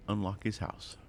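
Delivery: normal talking voice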